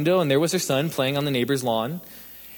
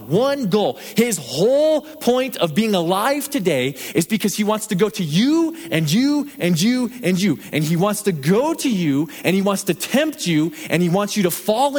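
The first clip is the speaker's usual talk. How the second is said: preaching at full force; 4 semitones higher than usual. Acted. Preacher warning the congregation about Satan, building to full force. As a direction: drive it home to the congregation.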